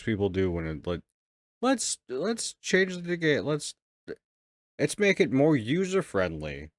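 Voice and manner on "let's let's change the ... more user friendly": high-pitched mock enthusiasm; mocking developers. Gamer mocking developers who remade the game, mock-eager. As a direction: imitate a developer's bright idea, much higher and drawn out at first.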